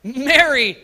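Jesus calling Mary. Shown as a demonstration. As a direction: cry out her name. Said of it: "How did he say Mary?".

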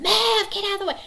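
loud, high mock scream; sudden shrieked order; screaming demonstration. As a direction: imitate someone rudely yelling to move. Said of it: "come at them screaming".

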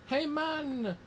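a bad stab at an Italian accent; mock Italian accent. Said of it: "he's Italian".

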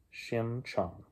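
ordinary delivery, nothing marked

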